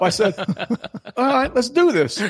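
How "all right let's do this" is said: high-pitched, game acceptance; retold resolve. Man standing up to accept the challenge, high hyped resolve. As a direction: rise to the dare, played up.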